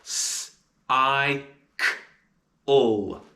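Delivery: s unvoiced, I, k, l apart; sounding out cycle. Shown as a demonstration. Teacher sounding out cycle. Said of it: "And the sounds of cycle are".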